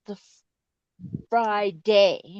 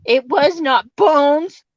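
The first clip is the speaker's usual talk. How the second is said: loud playful protest; 6 semitones higher, 9 dB louder than usual. Real, not acted. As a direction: insist it loudly, half joking.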